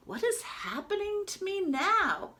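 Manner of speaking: high startled question; sudden alarm as the wave hits; high-pitched worried wonder; surprised duck. Acted.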